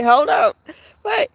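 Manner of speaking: ordinary talk, nothing marked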